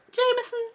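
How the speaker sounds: high, soft mock moan of a name; half-hearted lovers' moan. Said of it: "I don't want to moan the name".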